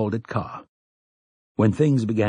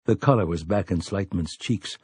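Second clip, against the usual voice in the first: ordinary delivery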